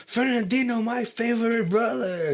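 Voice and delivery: high, fawning voice; gushing brother-in-law. Retold or imitated. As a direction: sweet-talk like a sucking-up brother. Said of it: "He's like".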